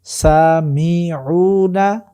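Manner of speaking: সামিউনা drawn out as saa-mi-uu-na; stretched, syllable-by-syllable সামিউনা; earlier-pattern pronunciation. Shown as a demonstration. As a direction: model সামিউনা slowly, keeping the middle i. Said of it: "আগে ছিল".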